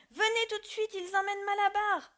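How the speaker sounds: high, loud urgent cry. Acted. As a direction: call for help at the top of the voice. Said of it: "criait-il".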